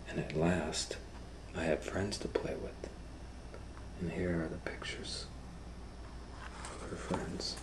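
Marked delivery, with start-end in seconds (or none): none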